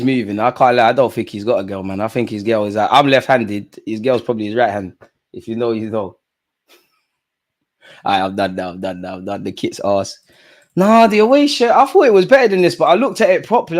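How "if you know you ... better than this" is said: ordinary talk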